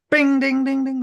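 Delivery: loud, high-pitched "bing, ding, ding, ding"; imitated door bell. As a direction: sing out a ringing shop bell.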